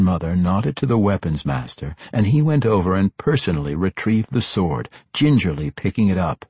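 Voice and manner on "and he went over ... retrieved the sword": ordinary delivery, nothing marked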